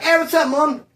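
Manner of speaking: overly chipper, pretending nothing's happening; mock cheerful. Son mockingly cheerful answering his worried mom. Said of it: "I'm not gonna be like".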